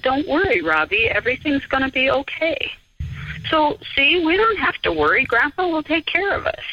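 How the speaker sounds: put-on high child voice promising Grandpa will help; reassuring little boy. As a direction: soothe your mother like a sure little boy.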